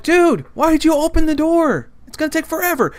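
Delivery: high, loud outraged complaint; furious. Retold or imitated. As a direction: yell it in exasperated disbelief. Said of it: "So I'm furious".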